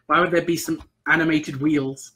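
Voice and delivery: ordinary delivery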